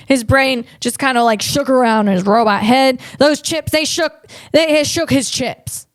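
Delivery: ordinary talk, nothing marked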